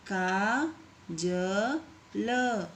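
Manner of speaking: Kajal stretched very slowly, syllable by syllable. Shown as a demonstration. Teacher to pupils, sounding out Kajal very slowly. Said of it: "इस तरीके से आपको शब्दों को पढ़ना है".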